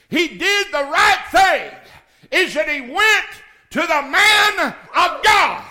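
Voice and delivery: high, shouted sermon cadence; loud, impassioned revival preacher; full-force preaching. Acted. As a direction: thunder it out to the whole congregation.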